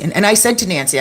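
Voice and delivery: ordinary talk, nothing marked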